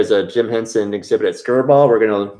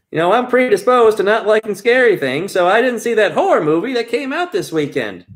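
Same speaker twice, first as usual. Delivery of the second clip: much higher hammy stage voice; vaudeville bad acting; 9 semitones higher than usual. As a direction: play it big for the cheap seats. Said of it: "Let's deliver it like we're in, in vaudeville".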